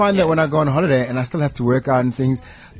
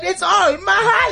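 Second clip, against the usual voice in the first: laughing. Real, not acted. Laughing at the Club Med joke.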